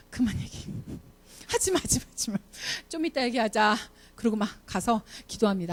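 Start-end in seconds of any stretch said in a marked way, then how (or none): 0.1-3.8 softer, half-whispered self-restraint; hushing herself. Pretend. Teacher stopping her own angry words, hushed. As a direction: clamp down and shush your own mouth.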